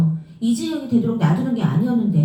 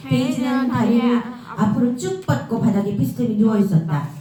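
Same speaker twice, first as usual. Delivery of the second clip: usual speaking voice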